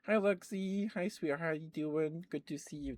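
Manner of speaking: high, bright hello to a viewer; cooing welcome to a chatter; sweet greeting. Real, not acted. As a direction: greet them like a pet. Greeting chat viewer warmly.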